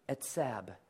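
"etzab" said slowly as "ets-ab", stress on the first syllable; Hebrew word etzab. Shown as a demonstration. Pastor teaching the Hebrew word "etzab" to the congregation, careful pronunciation. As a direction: say "etzab" low and deliberate, "ets-ab". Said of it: "Can you say etzab?".

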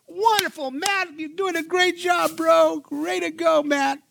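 high hammed-up praise; mock praise. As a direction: fake gushing approval. Imagined praise Matt expected.

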